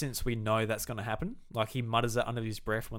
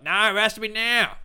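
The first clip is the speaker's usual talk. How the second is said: high, loud hammed-up demand; mock insistent villain; 10 semitones higher, 9 dB louder than usual. Fan mocks the villain demanding it happen now, loud and high.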